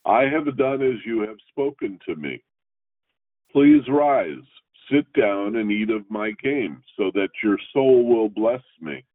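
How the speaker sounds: measured, formal reading of the Torah text; scripture reading. Acted. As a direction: read each verse out steadily like in synagogue.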